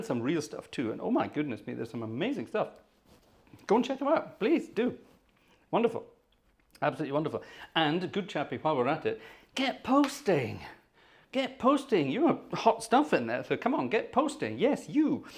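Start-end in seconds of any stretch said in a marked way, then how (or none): 3.7-6.0 much higher, eager urging; excited plug. Real, not acted. Urging viewers to the channel. 9.5-15.2 high, slow, teasing call to post; playful urging. Real, not acted.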